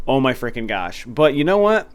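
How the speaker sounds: ordinary talk, nothing marked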